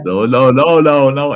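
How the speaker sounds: loud insistent no, no; firm no. Retold or imitated. Stopping a friend's dark joke, loud and firm. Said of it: "I said".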